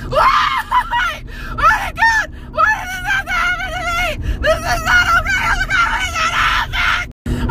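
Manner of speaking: very high, wailing cry; hysterical panic. Real, not acted. Driver wailing over the spider in the car, hysterical. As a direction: shriek and wail in despair.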